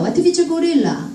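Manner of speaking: "What is a gorilla" as one run of sound; flowing, linked "What is a gorilla"; linked speech. Shown as a demonstration. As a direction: blur "What is a" into "watefitsa". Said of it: "이렇게 들립니다".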